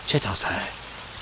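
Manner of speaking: Chitose breathed in a whisper. Shown as a demonstration. Gamer drilling the name, whispering Chitose. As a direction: whisper Chitose chee-TOH-seh. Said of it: "I'll say it, like, five times now".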